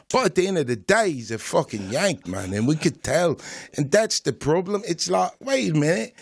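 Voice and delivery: London street accent, put on; mock British accent. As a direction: talk like a British road man. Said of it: "I don't know if I can stop talking like this".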